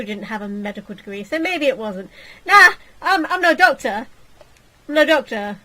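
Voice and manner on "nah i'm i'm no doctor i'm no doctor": excited joking. Real, not acted.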